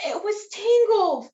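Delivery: high, loud alarmed tangled; shocked storyteller reveal; gasp. Acted. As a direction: gasp then stress tangled. Gasp before tangled wool.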